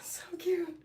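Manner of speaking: high, breathy whisper; whispered coo. Real, not acted. Streamer whispering over a cute cat. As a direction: whisper it to the kitten.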